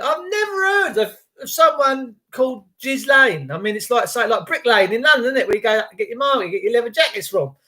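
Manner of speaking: ordinary delivery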